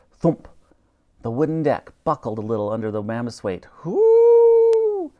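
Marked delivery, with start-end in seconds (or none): 3.8-5.1 very high loud hoo; long high animal call; mammoth hoot. A narrator's reading. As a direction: trumpet with relief. Mammoth lands on deck.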